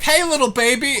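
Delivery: put-on high-pitched child's hello; kids at playground. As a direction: call out like a little kid to a baby. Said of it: "the other kids would be like".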